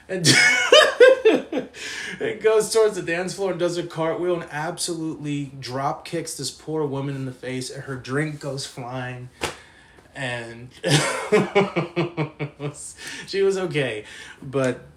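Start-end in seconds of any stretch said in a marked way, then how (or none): 0.3-2.1 loud high burst of laughing; real laughter. Real, not acted. Friend recalling Jimmy's cartwheel, laughing. 10.8-13.2 long giggling burst; helpless laugh at your own story; real laughter. Real, not acted. Friend recalling the cartwheel disaster, laughing. Laughs about the flying drink.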